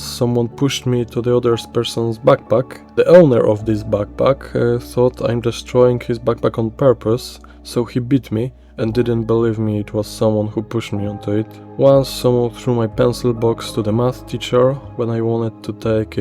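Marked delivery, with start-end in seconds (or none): none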